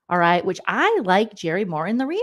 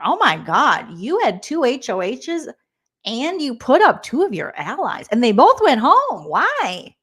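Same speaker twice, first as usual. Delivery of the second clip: higher, louder incredulous host; mock surprise; 5 semitones higher, 4 dB louder than usual. Vlogger imitates Jerry shocked at Quinn's choices, louder mock surprise. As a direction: act shocked by the news.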